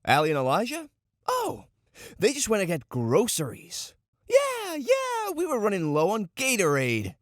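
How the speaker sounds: mock-casual lie with high, squeaky bursts; rehearsed cover story. [name] rehearsing the cover story for his teammates, hammed-up innocence. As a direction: overplay innocence while trying out a lie. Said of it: "I don't know, just lie".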